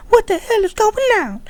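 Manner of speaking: squeaky cartoon-high voice, way up; mock friend voice. Mimicking his friend's freakout in a squeaky high voice. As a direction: pitch it way up like a panicked buddy. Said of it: "He's like".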